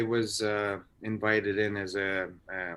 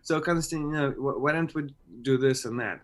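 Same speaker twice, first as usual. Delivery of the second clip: higher, lightly voiced director; a quick hint of the director talking; 5 semitones higher, 4 dB louder than usual. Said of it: "the director would be like".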